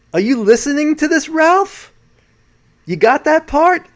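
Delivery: high, loud New York questioning; New York accent. Retold or imitated. Host quoting Sheehan checking on Ralph, high New York accent. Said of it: "That's my New York accent".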